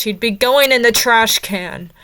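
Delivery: loud, slow verdict; emphatic. Real, not acted. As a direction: spell out the trash can verdict. Hates Rey, emphatic verdict.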